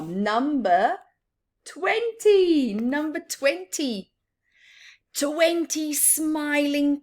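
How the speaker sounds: cheerful picture book reading; reading a rhyme aloud. Acted. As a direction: announce the big number with delight. Reading rhyming verse to children.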